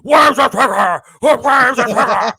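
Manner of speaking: loud, slow mock Batman shout; hammed-up gravel-voiced shout, slowed; Bale Batman impression. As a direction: bark it like Bale's Batman.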